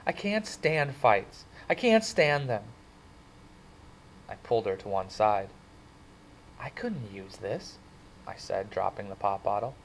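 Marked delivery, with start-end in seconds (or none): 0.0-2.6 slow, higher, shaken dread; shuddering. Acted. Cherry telling Ponyboy she can't stand fights, slow and shaken. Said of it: "Cherry shuddered". 6.6-7.8 quiet admission. Acted. Ponyboy confessing quietly.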